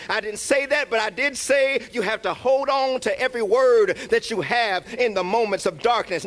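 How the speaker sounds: normal talking voice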